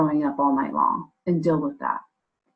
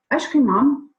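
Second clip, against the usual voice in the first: put-on child voice; 7 semitones higher, 3 dB louder than usual. Child's voice in retold story.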